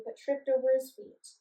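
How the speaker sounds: ordinary delivery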